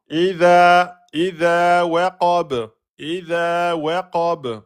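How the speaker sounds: slow chanted "Idha" with long vowels, short "waqab"; two-beat prolongation. Shown as a demonstration. Teacher modelling for students, "Idha" held two beats. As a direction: draw out "Idha", bounce off the b of "waqab". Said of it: "prolongation de temps".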